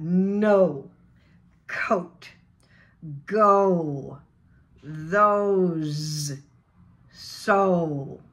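long O gliding to oo in No, Coat, Go; rounded O diphthong. Shown as a demonstration. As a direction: model each word slowly with rounded O. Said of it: "lips are rounded".

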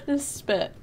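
high, giggly bursts of words; barely getting it out through real laughter; laughing hard. Real, not acted. Streamer asking if viewers get the joke, laughing hard. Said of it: "I don't know why I'm laughing so hard".

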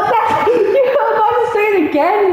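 high laughing shout. Real, not acted. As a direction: burst out laughing as you speak. Laughing at friend's slip.

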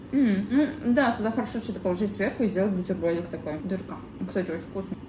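speaking between bites; chewing bread while talking. Real, not acted. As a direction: mouth partly full of bread. Seen 5: Woman bites dark bread at -2, lips closed chewing throughout.

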